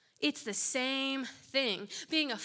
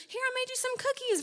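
much higher and louder, quick sugary offer; sugary mock giver; 14 semitones higher, 5 dB louder, faster than usual. Preacher mimicking a sugary cookie-giver, high and quick. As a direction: hand over cookies all sweetness.

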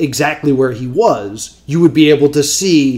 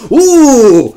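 high ooh; 7 semitones higher, 6 dB louder than usual. Pretend. Mock crowd reaction.

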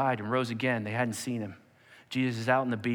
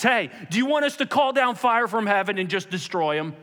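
loud, high, hot-headed call; acted fiery shout; yelling out; 5 semitones higher, 4 dB louder than usual. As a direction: yell it eagerly to the leader. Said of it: "He yells out to Jesus".

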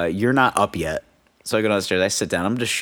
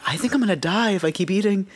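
overstuffed guest; 9 semitones higher than usual. Retold or imitated. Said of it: "I was just like".

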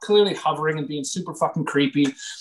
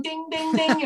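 streetcar bell; 12 semitones higher, slower than usual. Retold or imitated.

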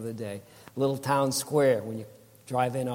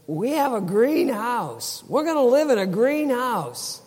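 imitated wife, much higher and louder, slow and emphatic; higher and louder, beaming conviction; insistent exclaiming; 12 semitones higher, 9 dB louder than usual. Wife insisting to sister-in-law, loud high excited declaration.

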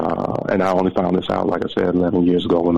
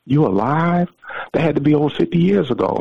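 higher-pitched astonished disbelief; incredulous, pitch lifted throughout; retold amazement; 8 semitones higher than usual. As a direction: imitate an old man marvelling at the news. Retold rescuer amazed caller is alive.

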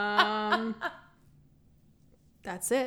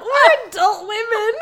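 laughing; 11 semitones higher, 6 dB louder than usual. Real, not acted. Laughing fit over silly game.

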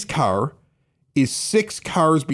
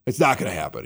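lower, flat and matter-of-fact; deadpan refusal; 9 semitones lower, 4 dB quieter, faster than usual. Retold or imitated. Refusing the valet's reverse plan, deadpan and low. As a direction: state it like there's no discussion. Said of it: "I just said just like that".

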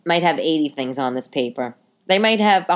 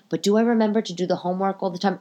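ordinary talk, nothing marked

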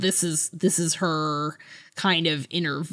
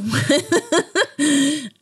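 bright high laugh; a quick real laugh; short amused laugh; 10 semitones higher, 3 dB louder than usual. Real, not acted. Laughing about Clone Wars cameo.

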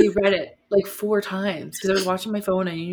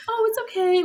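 mock sweet reassurance; 14 semitones higher than usual. Mocks a fake-comforting reply.

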